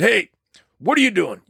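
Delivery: lightly voiced gruff tough guy; imitating Terry Leonard. Terry asks the narrator on the phone, gravelly macho voice. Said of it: "he's got this gravelly voice".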